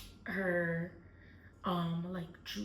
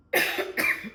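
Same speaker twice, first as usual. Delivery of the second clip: harsh choking cough; coughing fit; 9 dB louder than usual. Real, not acted. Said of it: "I'm choking".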